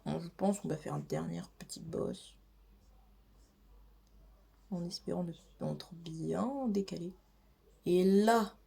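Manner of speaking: usual speaking voice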